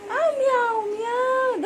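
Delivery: high mock cat meow; playful meow. Parent showing the baby a cat, high playful meow.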